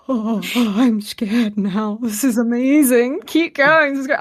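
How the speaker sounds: loud giddy delight at the threat; thrilled aside. Retold or imitated. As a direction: savour being scared, delighted. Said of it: "I was just there like".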